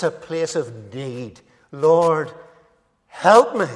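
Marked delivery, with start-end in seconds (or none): 1.7-3.7 crying out. Retold or imitated. A man crying out to the Lord, loud plea. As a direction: call out to the Lord for help. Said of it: "crying out to Jesus for help".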